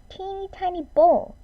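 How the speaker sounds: high-pitched storyteller emphasis; little baby bear pitch; teeny tiny voice. A narrator's reading. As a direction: lift it up high for something tiny. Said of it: "a teeny tiny little voice".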